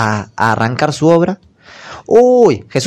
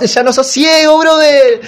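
much higher, louder flourish; showy miracle cry; 12 semitones higher, 4 dB louder, slower than usual. Acted. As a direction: announce it like a magic trick. Jesús cura al ciego. (Jesús milagrero, agudo y exclamando)

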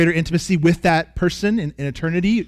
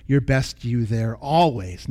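ordinary talk

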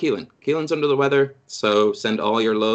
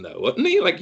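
usual speaking voice

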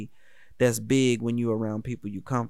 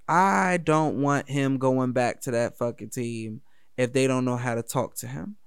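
normal talking voice

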